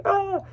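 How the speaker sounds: sudden high yelp of pain; mock the kid's sharp cry after a punch; imitating a punched kid's high yelp; retold pained yelp. Punched kid cries out.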